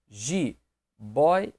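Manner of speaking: "jiboia" split as ji, boi, a; syllable by syllable. Shown as a demonstration. Teacher showing where the diphthong sits, "jiboia" syllable by syllable. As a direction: pause between each syllable of "jiboia": ji... boi... a. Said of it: "separei ideia".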